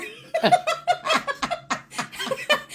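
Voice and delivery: long, high laugh; real laughing fit. Real, not acted. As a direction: burst out laughing.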